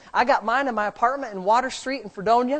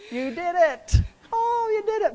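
much higher, delighted praise; overjoyed cheer to Emily; excited; 8 semitones higher than usual. Real, not acted. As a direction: burst out with joy at her success. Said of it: "Now stop and look at me".

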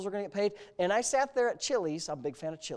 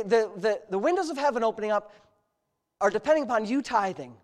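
normal talking voice

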